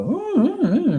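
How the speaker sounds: high whiny dog "ooh"; imitating the dog. Dog eager to greet the pool cleaner, as a high whine. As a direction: whimper like an excited dog. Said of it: "And she'll go".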